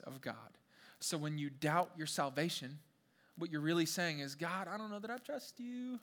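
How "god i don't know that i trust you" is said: soft, high, hesitant plea; mock doubting prayer. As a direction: imitate a doubter timidly admitting it to God. Voices doubter's prayer to God.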